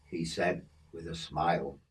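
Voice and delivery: usual speaking voice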